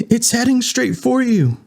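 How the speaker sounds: very high alarmed shout. Acted. Eddie warning Winston the monster's coming, high alarmed shout. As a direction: yell the warning. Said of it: "Eddie shouted".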